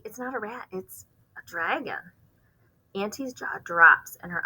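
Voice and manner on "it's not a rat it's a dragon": calm her down gently; hushed reassurance. Acted. Child soothes aunt.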